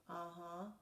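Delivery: lively read-aloud storytelling; story narration. A narrator's reading. Reading a bedtime story to children, animated. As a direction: read it to the kids with energy.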